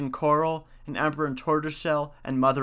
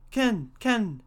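high imitated bird cry; two high pheasant calls; 7 semitones higher than usual. A narrator's reading. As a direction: call out like a storybook pheasant. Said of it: "said the pheasant".

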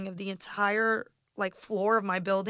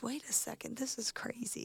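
amazed hushed whisper; 13 dB quieter than usual. Retold or imitated. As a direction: breathe it out in disbelief. Retelling her amazed reaction.

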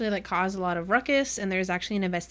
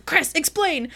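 retold demand; 7 semitones higher, 4 dB louder, slower than usual. Acts Thorn demanding an explanation.

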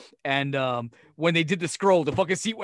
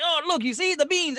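high, eager tour-guide voice, pitched way up; excited Italian host; 13 semitones higher than usual. Retold or imitated. Mimicking Stanley Tucci showing off the market beans, high and eager. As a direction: hype up the beans like a gushing host. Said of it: "It's like".

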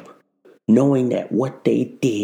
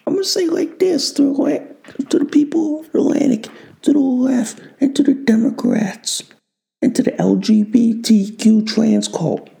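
high ranting address; 11 semitones higher than usual. Acted. Addressing the enemies.

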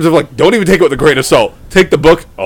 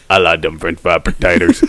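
very low, softer Sling Blade voice; 5 dB quieter than usual. Acted. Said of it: "redneck Aramaic".

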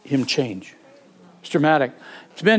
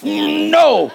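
sudden high yelp of refusal; imitating a friend's alarmed shout; startled no; 13 semitones higher, 6 dB louder than usual. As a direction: jerk back with a shrill no.

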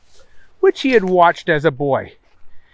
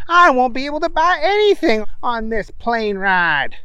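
a bit higher character voice; Booger voice; 8 semitones higher than usual. Acted. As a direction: raise the voice for Booger.

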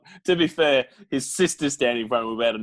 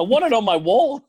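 mock greedy Sita; 9 semitones higher, 4 dB louder than usual.

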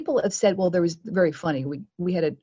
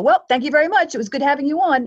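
cheery host sign-off; 8 semitones higher, 7 dB louder than usual. Retold or imitated. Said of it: "then they go".

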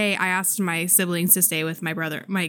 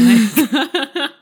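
laughing; 6 semitones higher, 7 dB louder than usual. Real, not acted. Laughs at own joke.

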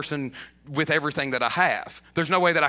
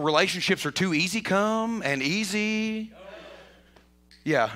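ordinary talk

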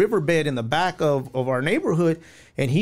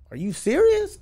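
jumps much higher in startled disbelief; stunned disbelief; 13 semitones higher than usual. Retold or imitated. To his homie, who says the cops never asked, stunned. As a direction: squeak it out like you can't believe it.

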